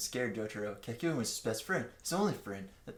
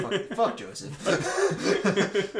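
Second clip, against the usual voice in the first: slow, high curse broken by laughter; louder laughing curse; 7 semitones higher, 6 dB louder, much slower than usual. Real, not acted. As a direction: laugh the name out. Laughing through the curse list.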